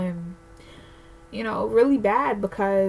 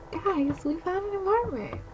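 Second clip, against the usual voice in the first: higher, bright announcement to viewers; telling viewers they found an apartment; excited news; 10 semitones higher, 5 dB quieter than usual. Real, not acted. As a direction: share the big news with delight.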